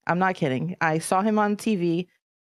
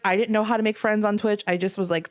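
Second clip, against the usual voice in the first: ordinary talk, nothing marked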